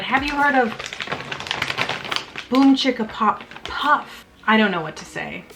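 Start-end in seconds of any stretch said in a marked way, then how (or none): none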